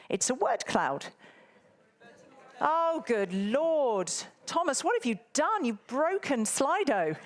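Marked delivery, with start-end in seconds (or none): 2.6-7.2 drawn-out, higher "good Lord", then teasing blame; exasperated but playful at the crash; mock dismay. Real, not acted. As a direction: pretend outrage at the broken poll. Slido poll breaks on screen.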